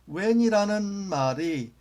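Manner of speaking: when stretched out high and loud; stress on when. Shown as a demonstration. Teacher introducing the next conjunction when, loud and drawn out. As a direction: hold the word when slow and loud. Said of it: "teacher stressing new conjunction".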